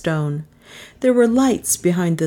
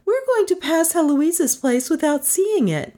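high-pitched urgent passenger; urgent Melissa; 11 semitones higher than usual. Acted. Passenger afraid of missing the house, high urgency. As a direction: warn the driver in a high voice.

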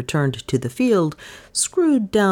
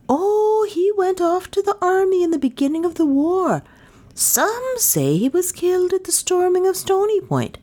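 much higher, piping villager voice; high-voiced villager; 11 semitones higher than usual. A narrator's reading. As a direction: answer in a high character voice.

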